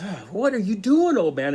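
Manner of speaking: high, loud, out-of-breath protest; a man surfacing, gasping and crying out high and loud; gasping alarmed cry. Retold or imitated. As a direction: gasp for air, then protest. Man coming up for air.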